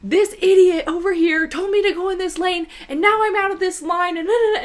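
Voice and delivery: loud, high retold tirade; wound-up venting, high and loud; mad, upset rant. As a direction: re-enact a worked-up complaint. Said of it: "I am getting so mad. I just start crying".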